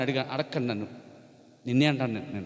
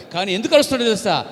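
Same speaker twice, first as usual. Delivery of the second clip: ordinary talk, nothing marked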